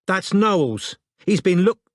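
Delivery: loud and close, cut off mid-word. A narrator's reading. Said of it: "much louder".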